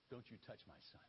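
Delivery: father's soft, dangerous warning; quiet menace. Acted. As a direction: hiss it as a low warning. Father protecting his son.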